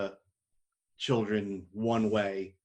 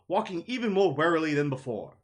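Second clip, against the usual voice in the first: ordinary delivery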